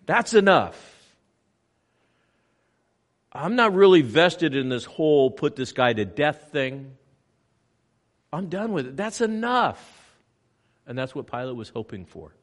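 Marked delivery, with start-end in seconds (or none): 0.1-0.9 "That's enough" higher and louder, nearly shouted; forceful that's enough. Retold or imitated. Imagined onlooker stopping the beating, forceful. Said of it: "look at his suffering and say". 3.3-10.0 higher, louder, exasperated; fed-up onlooker. Retold or imitated. Imagined sympathetic onlooker refusing to go on, exasperated. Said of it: "look at his suffering and say".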